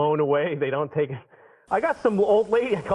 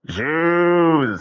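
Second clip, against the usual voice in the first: drawn-out animal call; long animal-noise delivery; 4 dB louder than usual. Acted. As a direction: moo the word like livestock. Voices racist farm animals.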